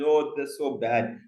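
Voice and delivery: normal talking voice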